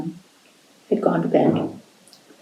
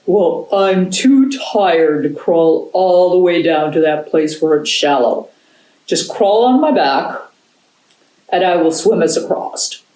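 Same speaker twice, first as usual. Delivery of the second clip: a touch of a weary big dog; tired dog voice; 3 dB louder than usual. Acted. Tired dog offers his back.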